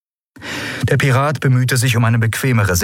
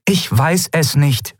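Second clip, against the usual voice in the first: slow, higher, word by word; emphatic repetition; 8 semitones higher, slower than usual. A narrator's reading. Woman repeating her doubt to the admiral, emphatic. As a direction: spell it out slowly, each word apart. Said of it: "wiederholte sie".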